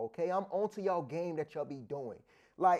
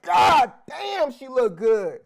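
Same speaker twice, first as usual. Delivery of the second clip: loud impressed exclamation; 5 semitones higher, 13 dB louder, much slower than usual. Retold or imitated. Reaction to her page.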